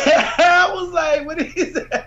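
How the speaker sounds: high, laughing squeal of disbelief. Real, not acted. Host laughs at the Trump clip, squealing high. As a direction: laugh it out, stunned.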